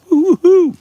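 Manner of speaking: very high, loud woo-hoo; excited whoop. Real, not acted. Driver cheering the coming strike, excited whoop. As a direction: let out a piercing, celebratory whoop. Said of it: "I'm getting so freaking excited".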